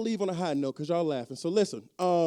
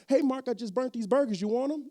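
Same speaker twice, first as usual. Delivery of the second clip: lightly higher, casual offering voice; offering leftovers; 6 semitones higher, 4 dB louder than usual. Retold or imitated. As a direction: offer the burnt burgers like a favor. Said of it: "Don't matter what it is".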